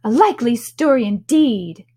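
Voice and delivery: deep contempt. A narrator's reading. Pigeon disbelieving Alice is a girl, contemptuous. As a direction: scoff at it in open disbelief. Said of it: "in a tone of deep contempt".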